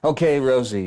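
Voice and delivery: French voice. Acted. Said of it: "this French voice says".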